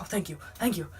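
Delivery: grovel out the thanks, hushed and stammering; groveling Gregory. Retold or imitated. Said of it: "he's like".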